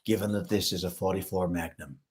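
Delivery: low, quiet tough-guy American; deep, menacing Magnum voice; movie tough-guy voice. Acted. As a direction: deliver it deep and hushed, like a film gunman. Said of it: "No, that voice is this".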